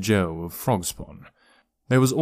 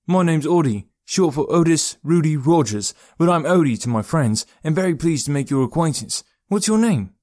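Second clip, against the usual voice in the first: high, lively, friendly introduction; chirpy young rascal, pitched high; cheeky, chatty boy; 6 semitones higher, 3 dB louder than usual. A narrator's reading. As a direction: introduce yourself brightly in a high boy's voice.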